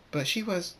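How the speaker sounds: high-pitched puzzlement; confused. A narrator's reading. Trixie tries to make sense of it, confused. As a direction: trail into bewilderment. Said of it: "powerful Trixie is confused".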